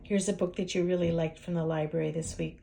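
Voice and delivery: plain reading voice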